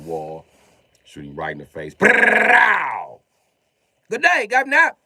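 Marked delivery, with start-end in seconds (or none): 1.9-3.2 loud high mouth gunfire burst; spray the room with your mouth; loud imitated gunfire; gunshot noise. 4.1-5.0 high, hammed-up British accent; high fake British voice; mock British. Mock British after kill.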